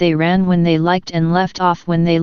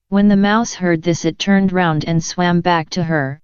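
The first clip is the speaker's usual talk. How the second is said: plain reading voice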